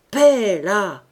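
loud, sudden call for silence; sharp, booming order; shouted command. Acted. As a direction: shout down a growling animal. Gruff master silences his growling animal.

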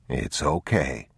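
low, slow, soft reassurance; calm a frightened child; soothing father. Acted.

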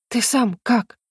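a touch of concern; worried question. A narrator's reading. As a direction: ask it with mild worry. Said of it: "с беспокойством спросила". (с лёгким беспокойством)

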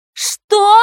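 loud, very high squeal; piercing high yelp; shrieked question. A narrator's reading. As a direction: shriek it in disbelief. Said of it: "взвизгнула Фэю".

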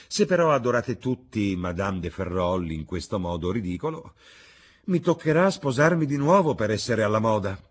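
amused hostess, animated. Acted. Hostess joking she must remarry, animated.

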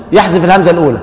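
ordinary talk

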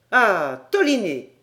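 loud, delighted exclamation of recognition; surprised cry. Acted. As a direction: call the name out, struck by recognition. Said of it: "s'écria Paganel". (exclamation surprise et forte)

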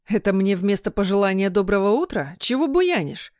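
ordinary delivery, nothing marked

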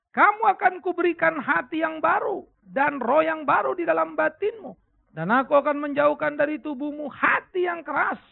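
loud, high, measured preaching voice; emphatic scripture reading. Acted. Preacher reads the verse aloud to the congregation, emphatic proclamation. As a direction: proclaim the verse forcefully to the hall.

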